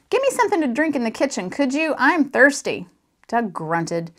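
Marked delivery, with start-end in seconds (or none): 0.1-2.9 demanding father. A narrator's reading. Father orders from TV chair.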